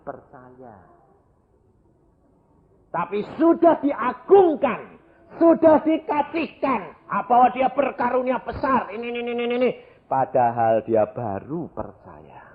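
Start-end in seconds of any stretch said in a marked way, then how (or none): 2.9-9.7 high-pitched, indignant preaching; impassioned preaching. Real, not acted. Preacher protests new believers being exalted too soon, impassioned preaching.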